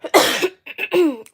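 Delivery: short forced cough; character cough. Shown as a demonstration. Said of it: "You'll hear me cough".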